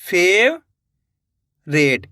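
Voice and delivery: Favourite drawn out very slowly, syllables joined; slow teacher blending; slow blended word. Shown as a demonstration. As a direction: stretch favourite out as the letters combine. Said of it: "तो मिलके बन गया".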